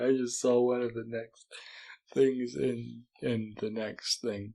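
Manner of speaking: ordinary delivery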